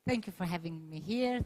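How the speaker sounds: ordinary talk, nothing marked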